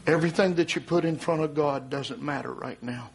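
low, measured warning; solemn preaching. Real, not acted. Preacher warns congregation of the coming flood, solemn and low. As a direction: bring it down low and grave, with long pauses.

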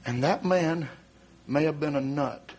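low, measured warning; solemn preaching. Real, not acted. Preacher warns congregation of the coming flood, solemn and low. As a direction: bring it down low and grave, with long pauses.

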